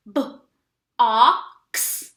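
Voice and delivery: "B-O-X" sounded out letter by letter; teacher sounds out "B-O-X" for the kids; sounding out. Shown as a demonstration. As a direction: sound out "B-O-X" slowly. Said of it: "I'm gonna sound it out. Watch".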